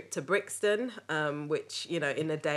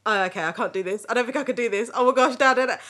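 freaking out; 6 semitones higher, 8 dB louder, faster than usual. Retold or imitated. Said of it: "I freak out".

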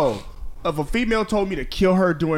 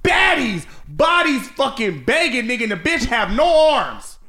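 excited rant; 7 semitones higher than usual. Real, not acted. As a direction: rant it all worked up. Heated rant about baddies.

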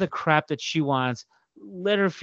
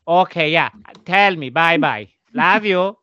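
loud, higher mother sign-off; imitating mom; 4 semitones higher, 8 dB louder, slower than usual. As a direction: mimic mom's eager goodbye on the phone. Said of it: "And she's like".

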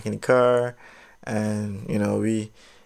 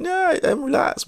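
high insisting. Retold or imitated. Insisting he's calm to officer.

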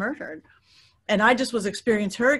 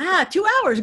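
laughing; 9 semitones higher, 6 dB louder than usual. Real, not acted. Laughing at own panic.

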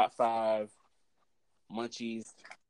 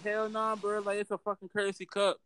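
raised, fast protest; heated retort; 8 semitones higher than usual. Retold or imitated. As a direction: argue it back, getting heated. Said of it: "I was like".

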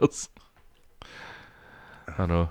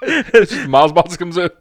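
louder and higher, laughing as the joke builds; 8 semitones higher, 7 dB louder, much slower than usual. Real, not acted. Two mates riffing on Mars bar measuring, laughing. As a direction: riff while cracking up.

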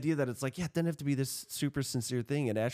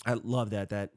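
ordinary talk

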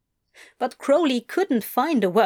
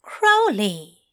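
firm, stern name call; a touch of stern schoolmaster; 3 dB louder than usual. Acted. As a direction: stop him short with one word. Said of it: "that stern look about him".

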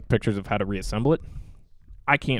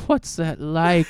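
sarcastic wistful; 6 semitones higher, 3 dB louder, much slower than usual. Acted. Sarcastic envy.

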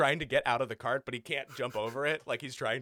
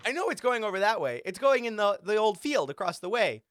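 gnome voice; 7 semitones higher, 6 dB louder, faster than usual. Acted. Gnome Galgan speaks in character.

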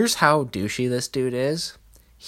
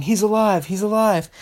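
higher, mock joyful shout; imitated yelling; 8 semitones higher than usual. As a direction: imitate a grandma yelling out the news. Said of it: "starts yelling".

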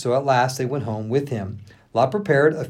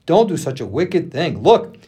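urgent, higher-pitched plea; louder, impassioned entreaty; begging; 6 semitones higher, 4 dB louder than usual. A narrator's reading. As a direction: plead hard with the crowd, voice raised. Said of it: "he begged".

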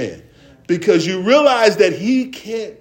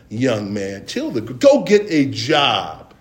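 ordinary talk

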